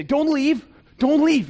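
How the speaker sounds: loud, high plea to stay; urgent shout. Pretend. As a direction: call them back before they leave. Urging fans at party.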